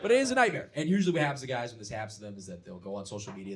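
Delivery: ordinary delivery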